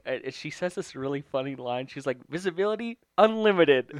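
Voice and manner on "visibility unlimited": put-on high, loud kid adventurer's cry; mock yelling. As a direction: imitate a girl yelling out her adventure line. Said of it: "yelling about".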